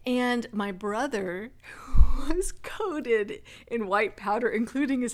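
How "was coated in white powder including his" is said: laughing. Real, not acted. Storyteller recalling brother coated in powder, laughing.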